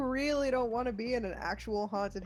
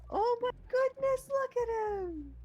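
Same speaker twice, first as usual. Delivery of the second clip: much higher, gushing over it; excited; 4 dB louder, slower than usual. Real, not acted. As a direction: coo with delight. Finding a cute new toy.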